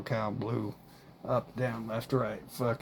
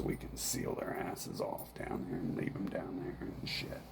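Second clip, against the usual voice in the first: whispering; 3 semitones lower, 7 dB quieter than usual. Real, not acted. Drops to whisper.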